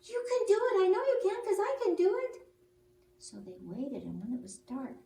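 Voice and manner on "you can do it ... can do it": squeaky, loud cheering on; high and loud, urging them on; high encouraging bat voice. Acted. Bat encourages scared birds.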